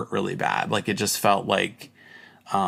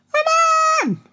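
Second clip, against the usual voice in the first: very high, squeaky little voice; tiny soldier voice; 6 dB louder than usual. Pretend. Voicing sperm as little soldiers, squeaky high plea. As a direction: squeak it like a tiny trapped creature. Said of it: "That's how they talk, little soldiers".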